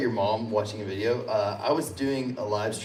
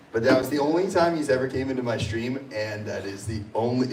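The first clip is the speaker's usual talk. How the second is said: ordinary talk, nothing marked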